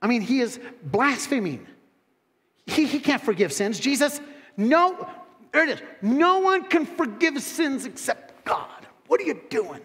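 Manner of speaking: high, indignant mock voice of the scribes; hammed-up outraged religious critic; scornful Bible experts. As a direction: sneer it like a smug critic. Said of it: "They were thinking to themselves".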